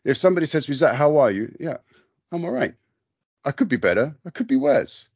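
ordinary talk

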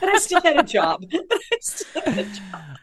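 high, laughing so hard words dissolve; real laughter. Real, not acted. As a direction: squeeze the words out between laughs. Laughing over keeping her job.

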